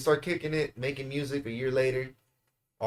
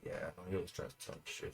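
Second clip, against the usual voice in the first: quick mumble; 8 semitones lower, 16 dB quieter, faster than usual. Retold or imitated. Friend shrugging off brother's warning.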